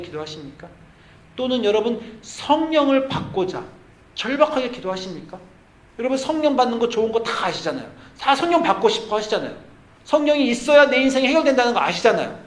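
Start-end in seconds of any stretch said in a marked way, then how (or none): 1.4-12.4 higher, louder build of a preacher; fervent preaching. Acted. 성령을 구하라고 회중을 다그치는 설교자의 고조되는 외침. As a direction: press the congregation with rising fervor.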